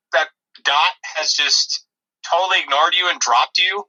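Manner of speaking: normal talking voice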